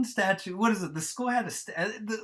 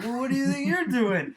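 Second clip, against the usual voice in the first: mock comeback; 8 semitones higher, 4 dB louder than usual. Acted quarrel retold.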